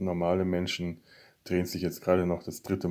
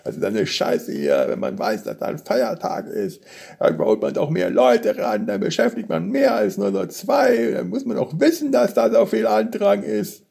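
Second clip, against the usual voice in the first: much higher, louder angry rant; 13 semitones higher, 5 dB louder than usual. Retold or imitated. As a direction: rant like a furious customer. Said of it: "hat da rumgekotzt".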